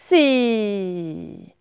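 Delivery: loud, drawn-out hiss; snake hiss. Acted. Pickle scaring Madame E, loud snake hiss. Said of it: "en imitant le serpent".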